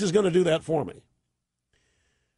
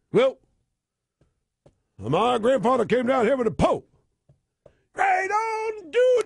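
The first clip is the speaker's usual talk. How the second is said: much higher, put-on street accent; Obama's mock street accent; 5 semitones higher than usual. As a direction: ham up a folksy accent, sliding up high on dude. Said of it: "Obama says things like".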